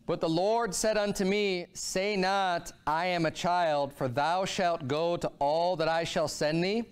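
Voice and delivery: normal talking voice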